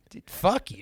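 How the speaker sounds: "Dude, fuck you" thrown out high, like a hothead. Retold or imitated. Trent cursing the stranger again.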